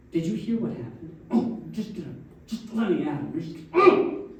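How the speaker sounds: imitating someone fuming, rising to a burst at the end; mock outrage. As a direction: play the hothead itching to fight. Acting out blood-boiling anger.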